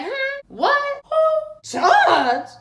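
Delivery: high, stunned squeaks of disbelief; shocked disbelief. Retold or imitated. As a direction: everyone gasping in confusion at the news. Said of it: "Everybody was like".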